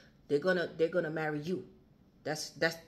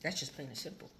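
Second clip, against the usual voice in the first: ordinary talk, nothing marked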